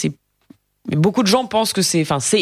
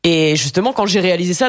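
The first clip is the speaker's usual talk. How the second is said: normal talking voice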